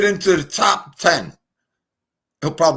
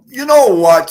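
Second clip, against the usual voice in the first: mock shout; 7 semitones higher, 10 dB louder than usual. Calling out a chat member.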